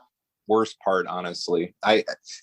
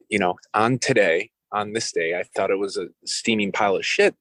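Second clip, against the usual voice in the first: ordinary delivery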